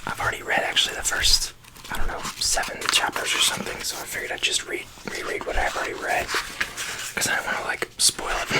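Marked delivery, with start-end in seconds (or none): none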